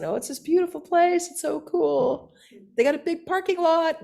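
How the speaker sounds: high-pitched, gushing pitch for the place; enthusiastic companion. Retold or imitated. Young man selling the temple to his leafleting partner, high gushing enthusiasm. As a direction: mimic a keen friend, voice up high.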